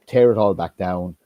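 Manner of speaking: normal talking voice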